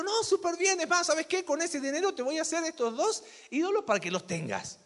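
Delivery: high, loud mock of a doting mother cheering her son on; indulgent mother. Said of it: "Pero la mamá que dijo". (madre consentidora imitada, aguda y fuerte)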